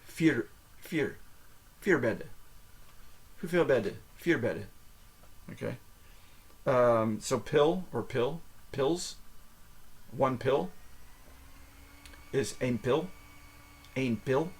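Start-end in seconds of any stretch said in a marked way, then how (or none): 0.1-2.3 Vier ending in an American R, like "fear". Shown as a demonstration. Teacher drills the number four, American R. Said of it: "becomes like an American R". 12.6-14.5 pil with the P softened almost to a B; P near B. Shown as a demonstration. Teacher models Dutch for one pill, P near B. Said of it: "The P almost sounds like a B".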